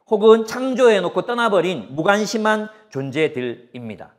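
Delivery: fervent sermon, building in volume; preaching. Acted. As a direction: drive the point home from the pulpit. Preaching with rising force.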